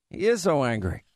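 normal talking voice

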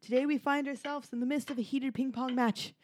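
normal talking voice

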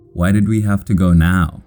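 plain reading voice

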